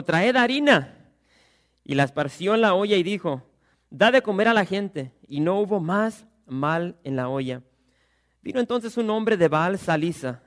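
projected, declaiming preacher's voice; scripture reading. Acted. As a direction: read it out to a whole congregation. Reads the scripture aloud.

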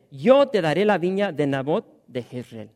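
loud, ringing Bible reading; scripture reading. Acted. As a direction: proclaim the text to the congregation. Reads scripture aloud.

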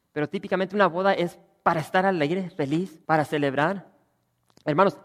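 loud, fervent pulpit preaching; full-force preaching. Acted. Pastor urging his congregation about the wedding, loud fervent preaching.

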